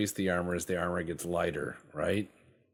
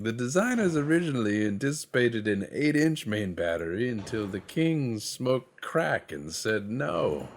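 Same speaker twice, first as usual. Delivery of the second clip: higher, louder, hammed-up history narrator; mock documentary narrator; 5 semitones higher, 4 dB louder than usual. Mocking a history show about the Habsburg king, hammed-up narrator. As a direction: ham up a pompous history lecture. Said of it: "It's like".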